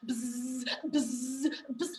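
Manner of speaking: short low buzzing bursts; wasp buzz. Pretend. Imitates a wasp's buzz.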